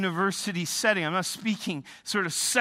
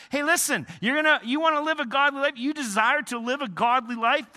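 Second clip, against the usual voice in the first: high, loud, fast challenge; preaching at full force; 7 semitones higher, 6 dB louder, faster than usual. Acted. Preacher challenging would-be godly believers, loud and high. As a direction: challenge them head-on.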